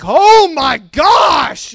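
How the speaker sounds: slow, loud, drawn-out high gasp; shocked gasp. Retold or imitated. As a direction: stretch it out in mock shock. Congregation's shocked reaction retold.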